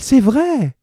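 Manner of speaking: high, loud dramatic cry; exclaiming. Acted. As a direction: cry out as the startled wife. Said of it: "s'écria-t-elle".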